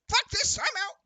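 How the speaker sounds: high, loud panicked bird-man voice; panicked squawk. Acted. Kenku runs past the porch, high loud panic. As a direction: squawk it and flee. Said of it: "and goes".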